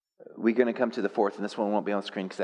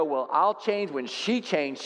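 slow, sulky mock husband; stubborn husband quote; 3 semitones higher, slower than usual. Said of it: "don't sit there and go".

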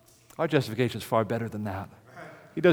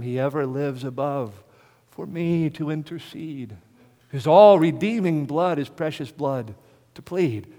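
hymn recitation; 3 semitones higher, 4 dB louder, slower than usual. Acted.

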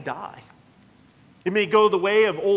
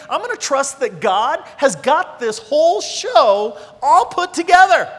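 louder and higher, building to a ringing peak; rally the church, rising with each line; fiery sermon climax with a laugh; preaching at full force; 7 semitones higher, 8 dB louder than usual. Real, not acted.

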